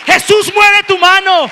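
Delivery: high, slow, full-force shout; crying out. Acted. Preacher as the one who rose up differently, crying out. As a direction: call on Jesus over the crowd. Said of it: "alguien se levantó diferente a decir".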